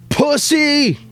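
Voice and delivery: high-pitched mock yell; imitated yell. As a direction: imitate a heckle out a car window. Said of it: "he yells out the window".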